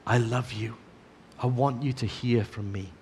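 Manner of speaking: soft tender I love you; gentle voice of God. Acted. Preacher voicing God's gentle whisper to listeners, softly. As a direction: speak it softly, like a loving whisper. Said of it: "the gentle whisper of God saying".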